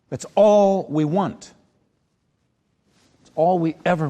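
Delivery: loud, high, emphatic rallying speech; impassioned urging. Real, not acted. Acting coach urging students to open their hearts, impassioned.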